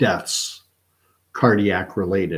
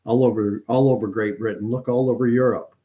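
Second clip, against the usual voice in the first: higher, louder mock official brush-off; British government; 4 semitones higher, 5 dB louder, faster than usual. Government brushing off excess deaths to the public, mock dismissive spokesman. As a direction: wave it all away in a mock government voice. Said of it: "According to the British government".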